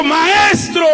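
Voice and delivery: weeping woman recognizing her teacher, shouting; moved cry. Acted. Burst out with joy on Maestro. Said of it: "Se emocionó la mujer".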